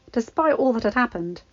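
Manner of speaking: ordinary delivery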